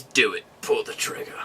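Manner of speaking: low, hushed villain voice; imitated villain dare. Retelling villain with gun at his head, low hushed dare. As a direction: sink to a near-whisper on the dare. Villain daring her to shoot.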